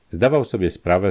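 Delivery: ordinary delivery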